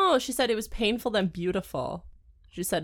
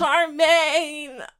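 hammy moan; 7 semitones higher, 7 dB louder than usual. Retold or imitated. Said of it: "Be like".

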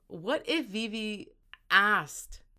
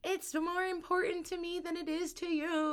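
higher, whiny mock plea; mock whiny pleading; 9 semitones higher, 5 dB quieter than usual. Retelling Tyrion's plea for the dress, mock whiny and higher. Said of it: "I was like".

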